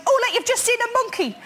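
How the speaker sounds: quick, high-pitched burst of inspiration; mimicking someone struck by an idea; sudden inspiration. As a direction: blurt it out as a brainwave. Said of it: "he got a real inspiration".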